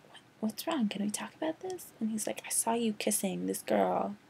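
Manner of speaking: imitating the couple whispering; mock hushed couple talk. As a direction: hiss it privately. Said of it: "then he's like".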